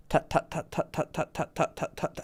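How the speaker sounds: soft clipped tut tut like hooves; horse trot clicks. Shown as a demonstration. Teaching a friend his speech is too even, steady tuts. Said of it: "you're like a horse that's".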